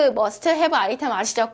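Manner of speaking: usual speaking voice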